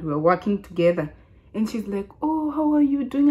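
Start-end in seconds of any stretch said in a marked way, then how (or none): 2.2-3.3 high-pitched imitation of a friendly old lady; mimicked friendly greeting; seventy-year-old lady. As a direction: greet in a raised, put-on elderly lady's voice. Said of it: "like, seventy years old".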